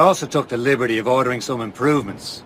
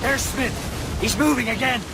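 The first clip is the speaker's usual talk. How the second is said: alarmed call; 9 semitones higher, slower than usual. Acted. Spotting the enemy ship.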